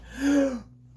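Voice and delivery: high, loud startled gasp; big gasp. Acted. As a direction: gasp as if the book surprised you. Startled reader reacts.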